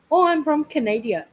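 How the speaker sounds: hammed-up Canadian accent. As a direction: imitate a chirpy Canadian nurse's accent. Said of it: "I could tell in her voice".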